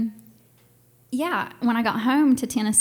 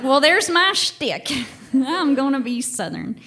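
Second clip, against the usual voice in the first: louder, slow Southern drawl with a laugh; a hammed-up Southern accent; playful Southern; 7 semitones higher, 7 dB louder than usual. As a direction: declare it like a proud Southern belle. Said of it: "I'm like".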